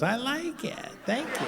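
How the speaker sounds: high, soft motherly voice; mom impression, pleased. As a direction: put on a mom's small high voice. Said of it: "My mom goes".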